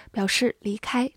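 normal talking voice

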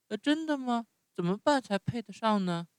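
高出许多的童声，嚷着抱怨; 像受够了的小孩那样抱怨; 木偶不耐烦地叫嚷. Acted. Narrator voices Pinocchio. (用很高的童声不耐烦地嚷嚷)

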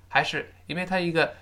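normal talking voice

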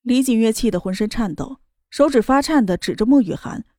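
usual speaking voice

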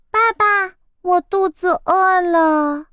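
put-on high, petulant child voice; spoiled egg girl telling daddy she's hungry; willful little chick. A narrator's reading. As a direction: whine like a spoiled kid who wants food now. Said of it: "任性地说道".

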